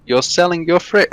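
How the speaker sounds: high, questioning mock Polish voice; imitated Polish accent. Polish buyer questioning the homeowner again, high mock Polish accent. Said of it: "Very Polish".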